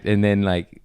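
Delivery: ordinary talk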